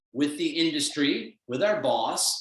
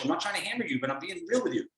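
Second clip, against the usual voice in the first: ordinary talk, nothing marked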